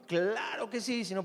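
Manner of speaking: ordinary talk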